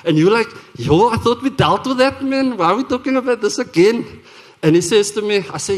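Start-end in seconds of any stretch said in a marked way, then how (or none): 0.8-4.2 higher-pitched protest at dad; acted exasperation, pitched up; exasperated child. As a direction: whine mildly at having it brought up. Voices child fed up with dad.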